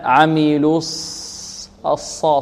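عملوا الص drawn out slowly, ص heavy without rounded lips; correct lip release. Shown as a demonstration. Teacher giving the right reading, unrounded heavy ص. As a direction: relax the lips on الصا. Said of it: "ولا نستعمل الشفتين في الصاد".

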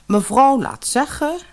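hoog en stijf deftig; deftige bediende. Acted. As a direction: een hooghartige bediende. (deftige bediendestem)

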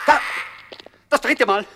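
high, loud frightened exclaim; terrified at the omen; alarmed cry. Acted. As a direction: panic at the third caw. Crow calls third time, panic.

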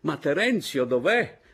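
high, loud questioning call; calling demand. Acted. Father in foam turban asking after Terenzio, calling loudly.

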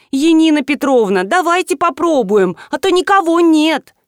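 high, wheedling whine; нытьё. A narrator's reading. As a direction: staff pleading with their boss. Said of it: "заныли". (ноющим высоким голосом упрашивая)

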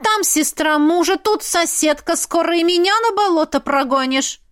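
heated village woman's reproach; indignant. A narrator's reading. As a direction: tell her off in outrage. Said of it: "возмутилась пряха Ильна".

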